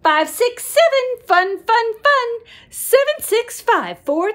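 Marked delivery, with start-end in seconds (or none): none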